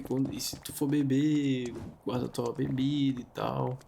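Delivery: usual speaking voice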